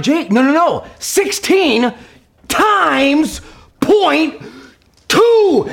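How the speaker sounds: rising to a high frustrated shout; exasperated yell. Retold or imitated. Dad losing it over fractions, climbing into a shout. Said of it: "say it louder and louder and finally scream".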